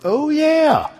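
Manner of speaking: high, bright oh-yeah of remembering; like it just hit him; mock dawning realization; sudden realization.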